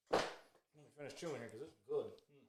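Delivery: normal talking voice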